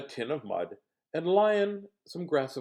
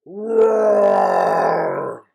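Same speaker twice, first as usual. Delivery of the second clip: long, loud bear roar; cranky roar; 6 semitones higher, 14 dB louder than usual. Acted. Bear roars from the cave, loud and cranky. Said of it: "came a very cranky roar".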